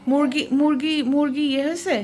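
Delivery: loud, high call across the house. Real, not acted. Wife calling out to the maid about the chicken, loud and high. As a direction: shout the name to reach another room.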